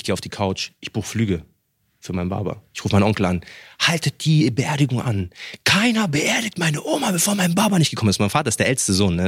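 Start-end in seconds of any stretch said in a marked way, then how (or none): 3.8-8.2 urgent demand. Retold or imitated. Demanding uncle delay the funeral.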